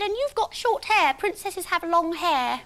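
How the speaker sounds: put-on a cheeky six-year-old pointing it out; squeaky kid voice; bratty little girl. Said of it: "she said".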